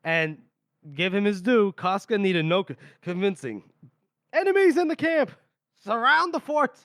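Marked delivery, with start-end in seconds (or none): 4.3-6.8 high, forceful mock battle commands; shouted orders. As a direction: bark out orders across a camp, acted in retelling. General shouting orders to his camp.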